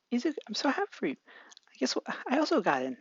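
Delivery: high, fast, gushing voice. Acted. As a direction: rush it out overjoyed.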